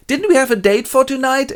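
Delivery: high, loud mock protest; calling after her. Man calls after Bianca as she runs off, high plaintive shout. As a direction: call out after someone leaving.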